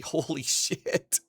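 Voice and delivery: usual speaking voice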